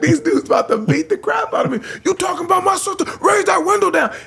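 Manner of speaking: high, fast mock threat; threatening brothers. Brothers threatening the DJ over their sister, mock menace. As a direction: menace him like an angry brother.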